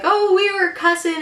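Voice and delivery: high-pitched mimicking of an accusation; mock tattling.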